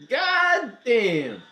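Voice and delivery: high, loud shouted surprise; whoa, he can't believe it; excited outburst. Real, not acted. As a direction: burst out with real hype. Reacting to cats descending on screen.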